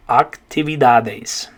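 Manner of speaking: Actividades slowly, ahk-tee-vee-DAH-des; slow Spanish model word. Shown as a demonstration. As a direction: repeat Actividades for students. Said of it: "the way you're gonna say".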